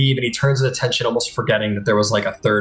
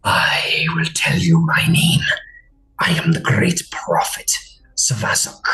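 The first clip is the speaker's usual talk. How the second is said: whispery alien creature; 3 semitones higher than usual. Acted. Captor confronting the Golden One, cold whisper. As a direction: hiss it out cold and accusing.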